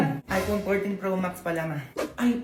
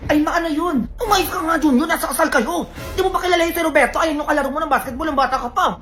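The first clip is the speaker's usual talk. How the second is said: scolding; 6 semitones higher, 3 dB louder than usual. Acted. Mother scolds son at wedding.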